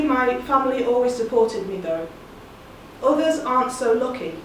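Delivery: plain reading voice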